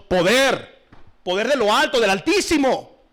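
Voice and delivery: loud, high fiery sermon; preaching at full force. Real, not acted. Preacher scolding the congregation, loud fiery preaching. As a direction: thunder it from the pulpit. Preacher stresses power from above.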